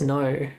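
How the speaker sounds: "no" ending in the name of the letter O; correct "no". Shown as a demonstration. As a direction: say "no" with a gliding o, no r. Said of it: "There's no R in there".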